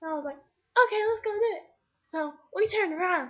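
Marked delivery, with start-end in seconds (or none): 0.4-1.6 high, loud retold eagerness; gung-ho agreement. Girl agreeing to join friend's fight, gung-ho. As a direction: jump in with acted enthusiasm.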